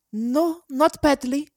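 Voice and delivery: put-on high child's reply; second child's voice. A narrator's reading. Other child in the bushes contradicts, high voice. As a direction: correct a sibling in a child's voice. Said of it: "as the other said".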